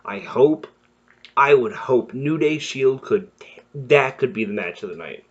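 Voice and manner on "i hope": ordinary talk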